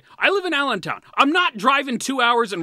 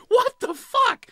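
incredulous squeal; 5 dB louder, slower than usual. Real, not acted. Incredulous outburst at team response.

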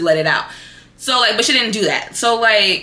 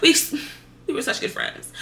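soft, low, sorrowful; sad; 7 semitones lower, 5 dB quieter than usual. Real, not acted. Missing her old friend, softly sorrowful. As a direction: let it fade to a whisper. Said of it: "so sad for me talking".

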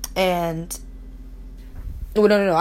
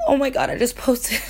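ordinary talk

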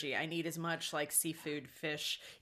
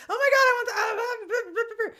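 much higher, louder, giggling squeal; giddy squeal; 9 dB louder than usual. Retold or imitated. Mom squeals at gold sprinkles, giggling and loud. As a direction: squeal like a kid at a candy counter. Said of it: "And I was like".

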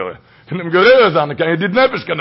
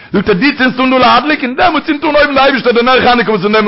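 loud, high fervent sermon; full-force preaching; 6 semitones higher, 7 dB louder than usual. Acted. The preacher expounding the Chanukah miracle to the table, fervent. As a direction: drive it home to the crowd.

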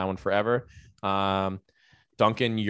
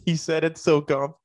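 laughing; 8 semitones higher, 3 dB louder than usual. Real, not acted.

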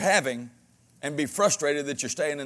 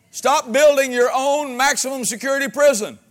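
preaching shout; 7 semitones higher, 5 dB louder than usual. Real, not acted. Preacher exhorting congregation.

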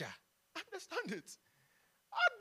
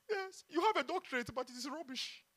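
usual speaking voice

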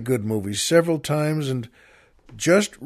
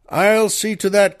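louder, higher promise; general crying out; 8 semitones higher, 7 dB louder than usual. Acted. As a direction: call it out firmly as the general. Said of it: "cried Sherman".

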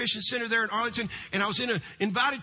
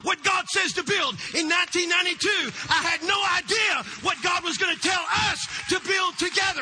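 full-force preaching; 7 semitones higher than usual. Acted. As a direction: preach it out, rising to a roar.

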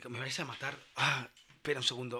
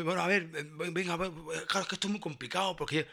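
fawning clerk excuses; 4 semitones higher than usual. Retold or imitated. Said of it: "Rollo de diciéndome".

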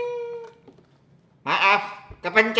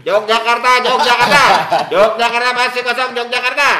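bus conductor calling; 7 semitones higher, 8 dB louder, faster than usual. Pretend.

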